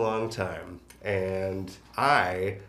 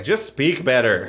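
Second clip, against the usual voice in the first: impatient teacher; 6 semitones higher, 9 dB louder than usual. Retold or imitated. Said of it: "He's very much just like".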